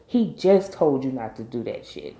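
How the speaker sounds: ordinary talk, nothing marked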